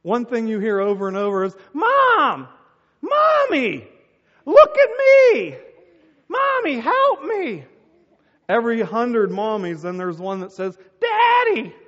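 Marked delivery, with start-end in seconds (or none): 1.7-7.6 put-on high, loud child yells; kids calling mom. Kids at the park yelling for their mother, shrill shouting. 11.0-11.7 put-on high, loud child's yell; kid calling dad. One kid among hundreds calling for dad, shouting.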